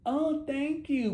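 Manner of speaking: high, slow, drawn-out simper; mock flattered woman. What she should not say to the stranger, mock flattered. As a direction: put on a swooning thank-you in mockery.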